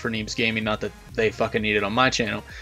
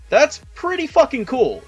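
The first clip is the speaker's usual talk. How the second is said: excited; 11 semitones higher, slower than usual. Real, not acted. Excited reaction to a world record.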